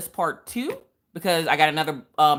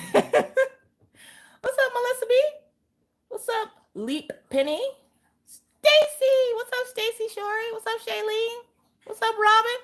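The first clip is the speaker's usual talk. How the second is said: much higher bright greeting to each chatter; high sing-song greetings. Acted. Host greeting her live chat in a high sing-song voice.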